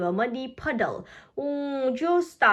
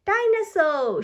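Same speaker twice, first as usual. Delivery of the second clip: high shouted word; 8 semitones higher than usual. Acted. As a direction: shout it out like a toddler. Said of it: "shouts George".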